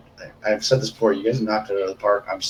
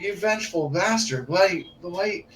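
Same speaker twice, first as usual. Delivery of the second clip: mock outrage; 6 semitones higher, 5 dB quieter than usual. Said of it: "I'm like".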